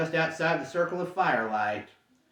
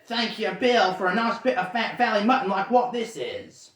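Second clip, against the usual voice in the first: higher, louder troll grumble; grumbling troll voice; 5 semitones higher, 4 dB louder than usual. Acted. Troll Tom complaining to Bert, grumbling troll voice. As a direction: moan at him like a greedy troll.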